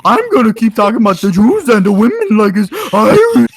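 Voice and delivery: loud smug parody of a troll; loud mock of an edgy troll. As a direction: sneer it out like a smug kid. Mocking an ironic troll.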